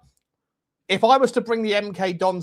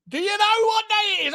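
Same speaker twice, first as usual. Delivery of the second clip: excited shout; 12 semitones higher, faster than usual. Acted. Hyped question to viewers.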